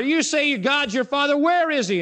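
a hint of a scornful crowd; taunting Pharisees. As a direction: goad him with the question.

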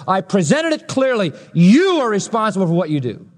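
normal talking voice

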